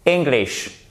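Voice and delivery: English with stressed ING, then falling; correct English. Shown as a demonstration. Teacher correcting the wrong versions, correct English. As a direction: say "English" as ING-glish, decisively. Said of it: "Et pas English ou English".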